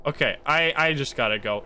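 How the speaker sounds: normal talking voice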